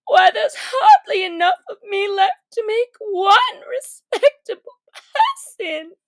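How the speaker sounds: put-on high girl's voice, laughing at herself; Alice rueful. Alice joking she's barely one person, rueful laugh. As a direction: let it climb into a rueful laugh.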